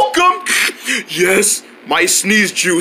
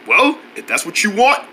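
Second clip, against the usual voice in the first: usual speaking voice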